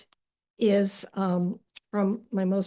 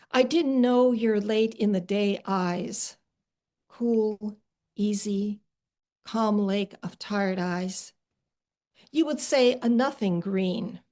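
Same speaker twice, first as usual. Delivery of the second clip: poem reading. Acted. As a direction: read it gently to a sister. Reading her own poem aloud.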